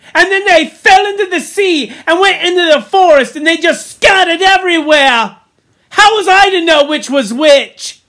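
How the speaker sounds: high, loud outraged protest; Piggy, indignant. A narrator's reading. Piggy explains the missing names to Ralph, indignant shouting. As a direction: shout your excuse as if wronged. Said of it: "cried Piggy indignantly".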